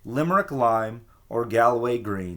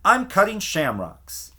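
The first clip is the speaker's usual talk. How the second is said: sharp; 7 semitones higher, 3 dB louder than usual. A narrator's reading. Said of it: "said Missus Donegal sharply".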